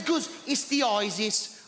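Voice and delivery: a hint of a Birmingham neighbor saying "isis"; mild Brummie accent, slow. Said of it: "She's from Birmingham".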